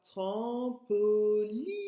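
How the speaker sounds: "Trampoline" by syllable, rising on a long I; stress on I. Shown as a demonstration. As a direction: stretch the li of "Trampoline" right out. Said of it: "C'est le son I qu'on entend".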